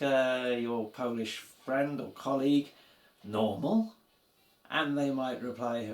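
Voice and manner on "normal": "Normal" asked with a rising end; questioning rise. Shown as a demonstration. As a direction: ask "Normal" as a casual question, lifting the last syllable. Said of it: "you can simply ask".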